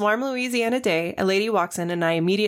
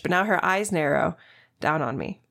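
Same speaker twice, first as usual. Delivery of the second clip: usual speaking voice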